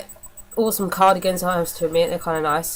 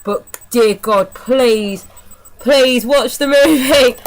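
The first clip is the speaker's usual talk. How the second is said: slow, louder begging; pleading; 4 semitones higher, 6 dB louder, much slower than usual. Real, not acted. Reviewer begging viewers to watch the terrible movie, slow and louder.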